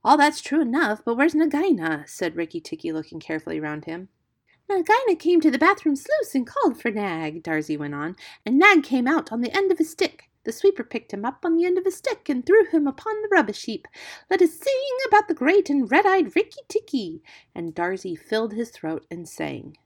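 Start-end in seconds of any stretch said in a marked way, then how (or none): 0.0-2.1 raised, higher, businesslike demand; mildly urgent hero's voice; impatient. A narrator's reading. Impatient hero interrupts the singing bird. 4.7-7.4 high, loud singsong bird voice; high-pitched feather-brained bird; exultant songbird. A narrator's reading. Voices Darzee the silly bird. 8.4-17.2 high, loud singsong bird voice; high-pitched feather-brained bird; exultant songbird. A narrator's reading. Voices Darzee the silly bird.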